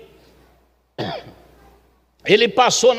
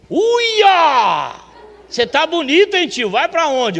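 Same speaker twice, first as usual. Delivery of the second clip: excited admiring Luiz; 7 semitones higher, 5 dB louder, slower than usual. Retold or imitated. Luiz greets him in a suit.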